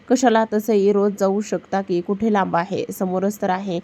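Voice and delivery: plain reading voice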